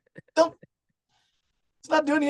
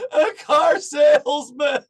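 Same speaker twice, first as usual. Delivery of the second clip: slow, loud, higher sobbing wail; crying answer; 4 semitones higher, 6 dB louder, slower than usual. Retold or imitated. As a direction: wail it out like a grown man in tears. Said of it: "and he's crying".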